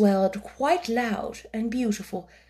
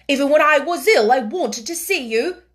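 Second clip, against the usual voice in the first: higher, louder shy eagerness; eager boy; 5 semitones higher, 7 dB louder than usual. A narrator's reading. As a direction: tell her you longed to see her. Colin voiced.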